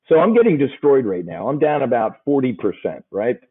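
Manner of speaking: ordinary delivery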